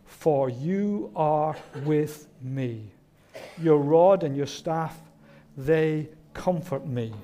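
slow, deliberate psalm reading; scripture recitation. Acted. Preacher quoting David's Psalm twenty-three, slow and measured. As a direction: recite it slowly, phrase by phrase.

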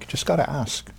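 usual speaking voice

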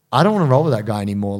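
ordinary talk

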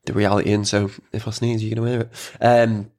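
usual speaking voice

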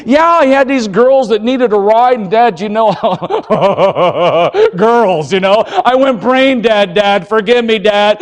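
excitable son with a nervous laugh; acted teenage confession to Dad; pleading son. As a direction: blurt out excuses, giggle, then beg. Acts son pleading with his father.

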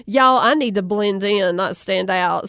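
ordinary delivery

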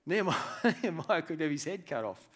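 usual speaking voice

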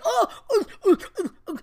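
high, startled stammering with a laugh; a man yelping in alarm; panicked stammer. Retold or imitated. Senior in wheelchair panics as rat jumps, high stammering yelp. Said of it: "And he was like".

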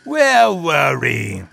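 put-on a lightly sinister old host, savouring each word; creepy horror host. Old Lord Piothr welcoming listeners, lightly sinister. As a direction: lure the listener in like a spooky storyteller.

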